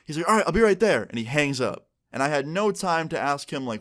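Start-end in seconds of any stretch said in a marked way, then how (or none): 0.3-1.1 loud, high, hurried-sounding sign-off; imitated hyped-up friend. Friend ending the phone call, loud and hyped. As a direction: raise pitch and volume like an amped caller. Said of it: "he just sounded so loud and hyped up".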